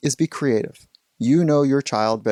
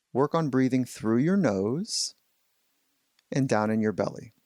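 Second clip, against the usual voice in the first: softer, measured meditation voice with pauses; guided meditation; 4 dB quieter than usual. Acted. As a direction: gently guide young listeners into settling and breathing.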